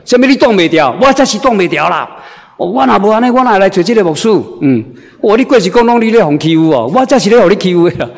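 a husband's mock angry comeback, brisk at the end. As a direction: snap back like an offended husband. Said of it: "生气了".

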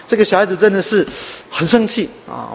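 ordinary talk

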